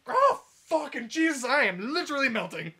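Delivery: high, loud wail of a melting soldier; acted agonized yell. Minotaur in the firebombed trenches, yelling in acted agony.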